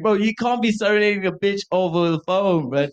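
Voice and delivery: high-pitched incredulous protest; mock exasperated yell. Retelling a friend serenading a crush, high mock outrage. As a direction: scold your pal in a strained high voice.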